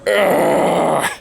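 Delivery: strained groan from chili burn; pained groan. Retold or imitated. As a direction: groan like a burning tongue. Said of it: "my whole tongue is like".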